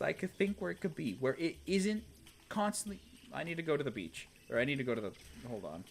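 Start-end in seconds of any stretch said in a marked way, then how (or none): none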